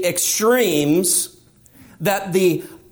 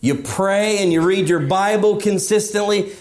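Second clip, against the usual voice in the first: usual speaking voice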